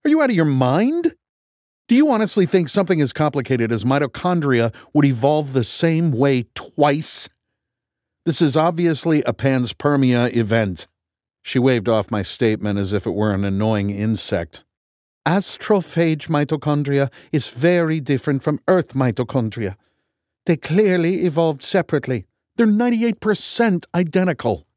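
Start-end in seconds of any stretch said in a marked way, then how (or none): 0.1-10.9 loud, high, incredulous; exasperated shout. A narrator's reading. Grace shouting in argument. 15.2-24.7 heated. A narrator's reading. Heated argument continues.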